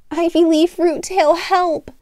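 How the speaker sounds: high, loud plea for help; pleading call. Acted. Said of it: "she pleaded".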